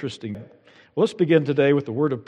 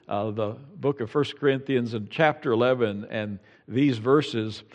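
ordinary talk

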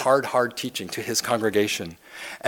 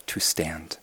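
sudden whispered "to stand."; hushed whisper after the build; dramatic whisper; 7 semitones lower, 10 dB quieter than usual. Acted. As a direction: drop to a breath to land the word. Dramatic drop after loud reading.

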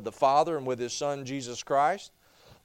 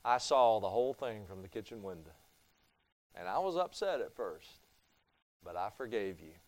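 soft, gentle grandmotherly forgiveness; tender grandma; 6 semitones lower, 7 dB quieter than usual. Retold or imitated. Grandma gently forgiving her grandson, softly. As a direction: quietly reassure him like a kind grandma. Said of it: "she says".

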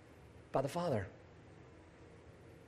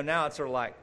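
ordinary delivery

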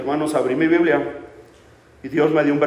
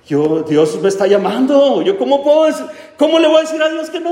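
impassioned plea; 11 semitones higher, 5 dB louder than usual. Retold or imitated. Pleading with mother, God calling.

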